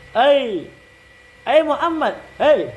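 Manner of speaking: high-pitched call "Ai Muhammad". Shown as a demonstration. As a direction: hail Muhammad from across the street. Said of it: "Yang ketiga".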